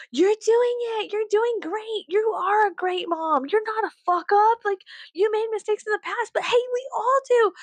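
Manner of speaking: high sweet encouraging voice; sugary high reassurance; affirming. Pretend. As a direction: cheer yourself on like a mirror pep talk. Self-affirming pep talk.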